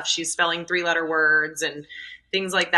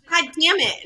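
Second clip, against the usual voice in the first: mimicked child voice, higher; cheeky kid repeats "God damn it"; toddler repeating the curse; 9 semitones higher than usual. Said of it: "And she's like".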